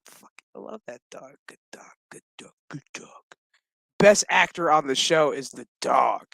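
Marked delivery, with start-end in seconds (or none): 0.1-3.1 very soft near-whisper; hushed adoration. Real, not acted. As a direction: murmur fondly to a dog under the breath. Hushed aside adoring the dog.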